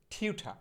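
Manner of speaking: টিউঠা with an aspirated ঠ and open final আ, no r; corrected pronunciation. Shown as a demonstration. Teacher correcting learners' word for tutor, টিউঠা with aspirated "tha". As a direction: end টিউঠা on "tha", dropping the r. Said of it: "আমার সাথে সাথে বলেন".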